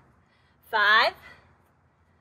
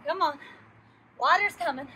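ordinary talk

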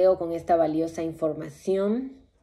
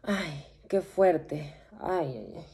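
soft dismayed moaning; shaken sigh; 2 semitones lower, 6 dB quieter than usual. Acted. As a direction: sigh it out, overwhelmed. Shaken after the vision.

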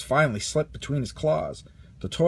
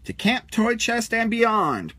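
higher, slow rallying call; heroic cry; 8 semitones higher, 4 dB louder, slower than usual. A narrator's reading. Space ranger rallying the toys, heroic cry. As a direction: declare it like a space hero's motto. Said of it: "cried Buzz".

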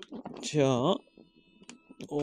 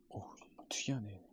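whispering; 5 semitones lower, 15 dB quieter than usual. Real, not acted. Quiet reaction while unboxing.

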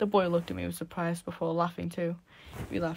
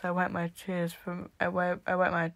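ordinary delivery

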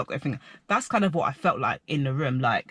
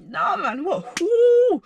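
high and loud, ending in a whoop; pumped up and ready to brawl, retold; mock hyped whoop; hyped shout; 14 semitones higher, 5 dB louder, much slower than usual. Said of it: "I was like".

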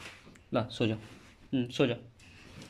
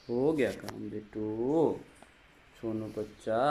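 slow, lowered, gentle reassurance; slow soothing; 4 semitones lower, 4 dB quieter, slower than usual. Real, not acted. Parent telling crying child work is done, slow soothing.

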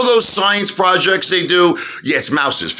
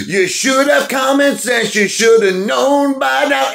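higher, slow, scolding each word; 7 semitones higher, slower than usual. Real, not acted. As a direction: lecture them slowly, fed up. Scolding the apes.

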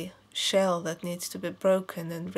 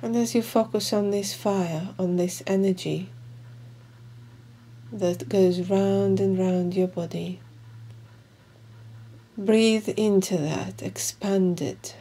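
slow, measured meditation guide with long pauses; guided meditation; 6 dB louder than usual. Acted. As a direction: guide them calmly through each breath, leaving room between phrases.

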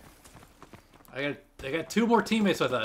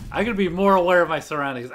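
ordinary talk, nothing marked

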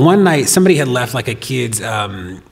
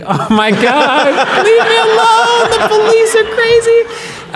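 much higher, louder, slow, squealing through laughter; laughing disbelief; 7 dB louder, much slower than usual. Retold or imitated. Retelling the officer's birthday wish, squealing with laughter. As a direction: shriek it out, half laughing, half appalled.

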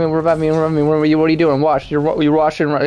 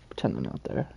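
whisper; 9 semitones lower, 14 dB quieter than usual. Real, not acted. Proposing to hide.

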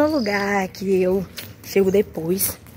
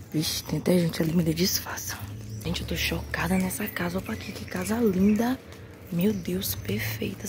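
hushed whisper so the people nearby won't hear; 2 semitones lower, 10 dB quieter than usual. Real, not acted. Vlogger whispering past strangers' house, hushed whisper. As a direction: whisper your awe, keep it secret.

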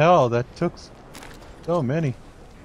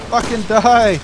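raging gamer; 4 semitones higher than usual. Real, not acted. Raging at boss fight.

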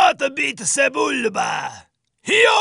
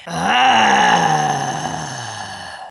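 normal talking voice

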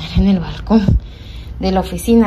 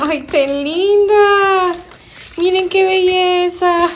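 drawn-out delight; 8 semitones higher, 3 dB louder, much slower than usual. Real, not acted. Admiring the gift pots.

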